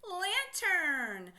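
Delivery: Lantern said high and loud, falling; proud reveal of Lantern to the class; answer reveal. Shown as a demonstration. Teacher reveals the blended word to young readers, bright and loud. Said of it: "And what do you get?".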